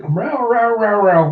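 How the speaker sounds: high yowls stepping down in pitch; mock yowling. Characters reacting to a reunion, mock high yowling. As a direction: yowl it repeatedly, starting high and dropping. Imitated animal-like wailing in the reunion.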